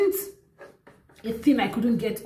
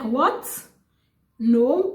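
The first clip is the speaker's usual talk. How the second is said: retold shock; 4 semitones higher, 4 dB louder than usual. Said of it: "I was like".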